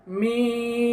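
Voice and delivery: slow drawn-out মিম, lips pressed lightly; letter name Mim. Shown as a demonstration. Teacher demonstrating মিম slowly for students. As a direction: hold মিম long with closed lips. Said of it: "শেষও মিম রয়েছে".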